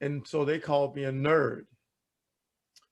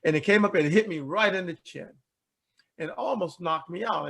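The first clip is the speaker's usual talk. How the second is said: normal talking voice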